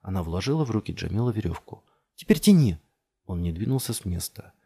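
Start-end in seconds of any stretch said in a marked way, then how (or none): 2.2-2.8 high, loud command; shouted order. A narrator's reading. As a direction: yell for him to haul. Order to pull rope. (громкая команда)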